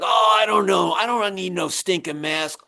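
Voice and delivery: loud, high, dismissive shout, slowing at the end; mock tough guy. Coworker scoffing at wearing his mask, mock shout. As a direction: bark it out like a careless worker brushing off safety.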